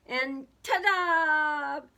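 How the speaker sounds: loud, high, drawn-out reveal; long, loud showy fanfare; triumphant ta-da. A narrator's reading. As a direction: stretch it out like a grand unveiling. Moose presents his surprise.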